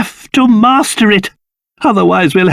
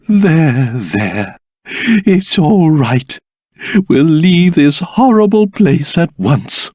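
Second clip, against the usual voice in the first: tender comfort; 5 semitones lower, slower than usual. Acted. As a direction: soothe a frightened child. Comforting his daughter.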